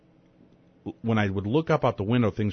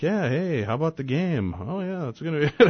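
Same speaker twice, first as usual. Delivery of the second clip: higher, breezy casual chatter as if nothing is wrong; mock nonchalant; 7 semitones higher than usual. Father recalling spinning car with son, mock nonchalant. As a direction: toss it off like small talk while spinning out. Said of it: "we were just so very nonchalant".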